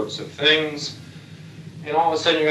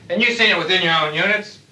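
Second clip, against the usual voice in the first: ordinary talk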